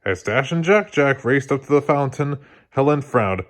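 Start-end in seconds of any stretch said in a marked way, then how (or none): none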